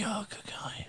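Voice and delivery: breathy unvoiced whisper of mock praise; whispered aside. Storyteller whispers sarcastic praise of the chatty drunk. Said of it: "And I was just like".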